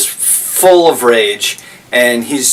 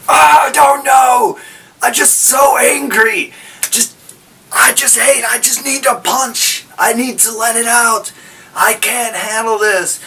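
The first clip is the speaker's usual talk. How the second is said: raging clone; 9 semitones higher than usual. Pretend. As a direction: let the rage burst out.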